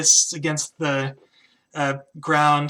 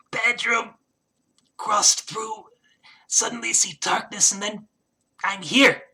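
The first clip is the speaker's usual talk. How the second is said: kobold voice; 5 semitones higher than usual. Acted. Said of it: "and he's like".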